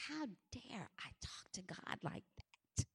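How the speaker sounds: very soft, unvoiced whisper to oneself; awestruck fearful whisper. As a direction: whisper in horror at your own nerve. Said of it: "I felt fear come over me".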